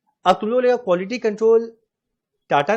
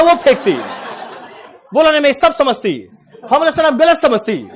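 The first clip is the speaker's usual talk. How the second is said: high, louder mock South Indian Hindi through laughter; 6 semitones higher, 4 dB louder than usual. Trainee and supervisor trade jabs in mock South Indian Hindi. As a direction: laugh while copying his accent. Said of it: "उसका tone का मजाक उड़ा रहा है".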